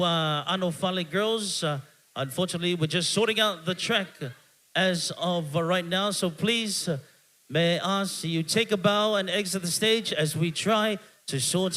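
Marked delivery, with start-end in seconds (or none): none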